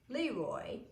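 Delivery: ordinary delivery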